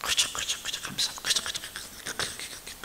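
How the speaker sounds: soft unvoiced murmur of thanks; hushed whispered thanksgiving; whispered prayer. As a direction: whisper the prayer like an old deaconess. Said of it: "그 권사님이 기도하는 게".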